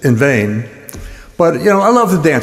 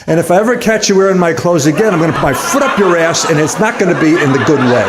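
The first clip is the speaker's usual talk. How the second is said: rapid-fire threat in one breath; hammed-up furious warning; mock angry scolding; 2 semitones higher, faster than usual. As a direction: suddenly tear into them, fast. Sudden angry scolding after slow commands.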